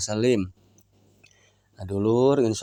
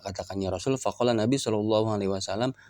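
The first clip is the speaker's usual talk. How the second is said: ordinary delivery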